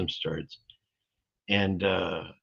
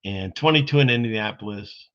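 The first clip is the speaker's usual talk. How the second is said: usual speaking voice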